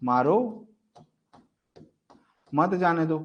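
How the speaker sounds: "मारो" alone, long pause, then "मत जाने दो"; pause after मारो. Shown as a demonstration. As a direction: break hard after मारो, join मत to जाने दो. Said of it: "इसी विराम चिन्हों का प्रयोग". ("मारो" के बाद लंबा विराम, फिर "मत जाने दो")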